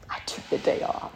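whispered under the breath; a sneaky little whisper. Retold or imitated. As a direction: hush it like a secret. Whispered sneaky aside in story.

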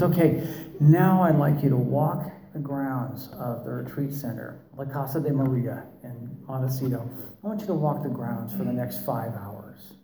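soft, hushed therapist voice, lightly mocked; a gentle, soothing instruction; imitating meditation voice. Therapist sending the group to walk the grounds, softly imitated. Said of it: "modulate your voice like that and talk like this".

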